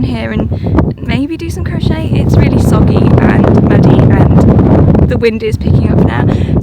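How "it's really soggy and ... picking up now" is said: shouting over wind. Real, not acted. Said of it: "I don't even know if you can hear me over the wind".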